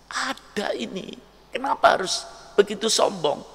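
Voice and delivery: high, soft tearful plea; crying. Acted. Wife crying at her arrogant husband, high and soft. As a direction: weep softly up high. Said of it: "Istrinya nangis".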